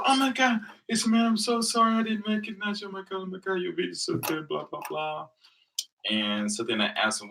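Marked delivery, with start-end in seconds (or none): 0.0-5.3 much higher, gushing mock fan voice; starstruck gushing. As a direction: imitate a starstruck fan squealing over a video. Starstruck guy recognizes him.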